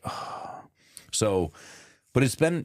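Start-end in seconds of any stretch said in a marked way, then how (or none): 0.1-0.6 soft voiceless "oh"; deflated sigh. Retold or imitated. Dad imitating son caught out, deflated sigh. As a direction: imitate a kid sighing as it sinks in. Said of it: "he was just kinda like".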